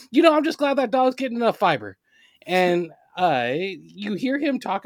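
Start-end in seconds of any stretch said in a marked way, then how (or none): 0.1-2.0 high, loud, lightly voiced Pete Carroll cheer; imitating Pete Carroll. Pete stepping in dog crap, mock-cheerful higher, louder coach. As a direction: beam like a relentlessly positive coach. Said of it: "and be like".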